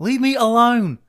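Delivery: high, loud mock whine; mock Oklahoma woman ranting. Podcast host ending her Walgreens rant, high loud mock whine. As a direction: squeal it out, fed up. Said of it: "then they're like".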